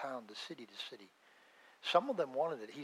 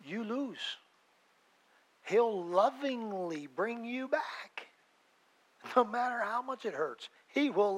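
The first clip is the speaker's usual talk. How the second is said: rising preacher fervor; 8 semitones higher, 5 dB louder, slower than usual. Real, not acted. Preaching climax on God's discipline.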